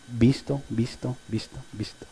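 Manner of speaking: fading repetition. Acted. Seen message, repeated fading.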